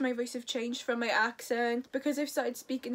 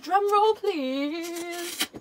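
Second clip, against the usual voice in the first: loud, high call for a drum roll; big announcer call; 4 semitones higher, 5 dB louder, much slower than usual. Pretend. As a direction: call it out big, stretching the last word. Announcing the crown reveal, calling out.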